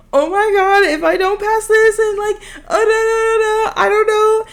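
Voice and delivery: high, loud, hammed-up wailing; mock boo-hooing. Student retelling her meltdown to the counselor, hammed-up wail. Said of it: "after boo-hooing in her office".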